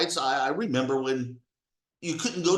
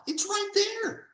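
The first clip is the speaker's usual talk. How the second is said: much higher, insistent pointing it out; higher emphatic insistence. Real, not acted. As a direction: say it high and pressing, as if tapping the page. Stressing the founders' words.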